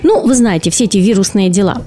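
normal talking voice

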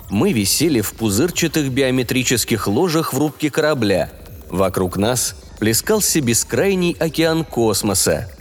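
plain reading voice

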